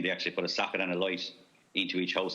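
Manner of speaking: ordinary delivery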